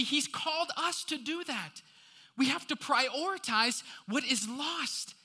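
high-pitched, insistent pulpit delivery; rousing sermon exhortation; impassioned preaching. Real, not acted. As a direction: press each point on the congregation. Preaches with raised voice.

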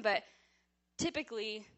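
usual speaking voice

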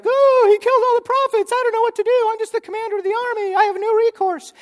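high, loud panicky whine; helpless husband whining; whiny weakling. Retold or imitated. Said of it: "Ahab's just like".

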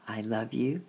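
ordinary delivery, nothing marked

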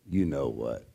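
low, soft, drawn-back delivery; understated joke payoff; knowing parrot punchline. Acted. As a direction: drop it low and quiet for the punchline.

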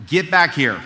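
loud, sharp call from mother; mom's stern shout. Retold or imitated. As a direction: shout it like an angry mother calling her son back. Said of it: "That's what she'd say".

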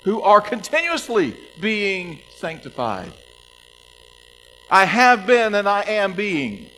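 high, loud and slow; fervent emphasis. Real, not acted. Preacher hammering home the message, loud and slow. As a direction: drive the point home with passion. Climax of the sermon point.

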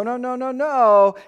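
usual speaking voice

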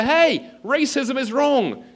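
very high, loud drawn-out shout; calling out. Acted. Student shouting to the crowds on campus, calling out. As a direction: holler a slogan over a crowd. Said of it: "you call out to the crowds".

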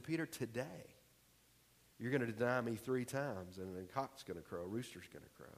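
gently, sadly foretell the denial, almost under the breath; hushed Jesus. Acted.

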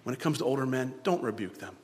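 put-on Paul advising young Timothy, soft and low; quiet fatherly counsel. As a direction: counsel him gently and quietly. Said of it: "he says".

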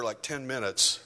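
ordinary talk

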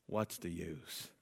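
slow, low and soft; despairing. Acted. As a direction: let the hope drain out. Elijah's despair voiced.

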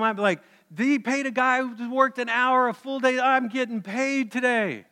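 the early workers dreaming of big pay, giddy and high; giddy excitement. Pretend. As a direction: act like a payday windfall is coming.

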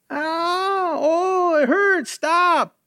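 high, crying cries of pain mimicked; imitated cries of pain. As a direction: voice the screams as a pleading prisoner. Guard imitating prisoner's screams.

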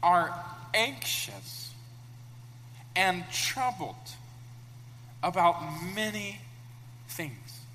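slow, calm and gentle; calm Jesus. Acted. Preacher voicing Jesus calmly answering an upset Martha. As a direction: ease each word out, soothing. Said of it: "And Jesus calmly".

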